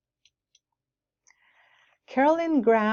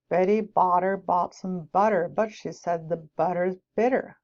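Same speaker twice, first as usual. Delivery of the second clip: American accent; 3 semitones lower than usual. Shown as a demonstration. Teacher demonstrating American pronunciation, American accent. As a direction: say "butter" the American way, flapped T.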